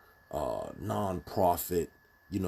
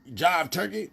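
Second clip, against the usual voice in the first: much higher, louder hammed-up seventies jive; jive street talk; 11 semitones higher, 8 dB louder than usual. Mocking how a governor should not talk, loud hammed-up jive. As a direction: camp it up like an old blaxploitation hustler. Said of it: "be like".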